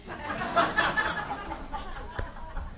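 high-pitched laugh at his own joke. Real, not acted. Pastor laughing at his own joke, real laugh. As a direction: burst out laughing at the punchline.

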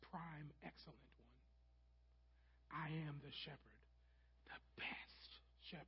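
soft slow whisper; hushed, slow. Shown as a demonstration. Preacher to congregation, slow reverent whisper. Preacher softens the literal translation.

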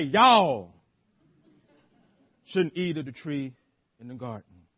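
put-on light Southern accent on the serpent's question; mildly Southern, with a punchy Y'all. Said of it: "if the serpent was southern".

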